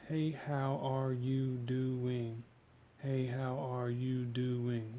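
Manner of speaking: each syllable drawn out slowly, to be counted; very slow greeting. Shown as a demonstration. Showing a listener how each syllable gets counted, very slowly. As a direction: stretch every syllable so it can be counted. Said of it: "those are counted".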